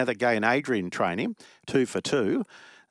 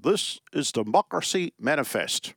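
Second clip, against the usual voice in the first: mock posh quote, pitched up; 5 semitones higher than usual. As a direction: imitate a plummy gent lightly. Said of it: "he had a very posh voice".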